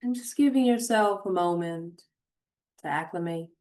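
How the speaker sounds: unhurried meditation voice with long pauses; guided meditation. Acted. Host guiding listeners to notice their surroundings, guided meditation. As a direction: guide them gently, phrase by phrase, leaving space.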